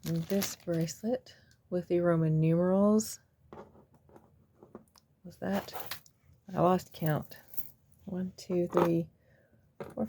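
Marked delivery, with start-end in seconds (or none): none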